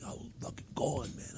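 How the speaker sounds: breathy whisper, imitating a blissed-out friend; whispered quote. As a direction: hush it like you're floating away. Retold blissed-out retreat quote.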